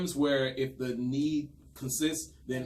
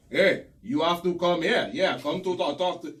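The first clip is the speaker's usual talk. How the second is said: mock bad Haitian accent; 5 semitones higher, 7 dB louder than usual. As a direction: ham up an actor's unconvincing Haitian accent. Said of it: "my Haitian accent is better than this dude".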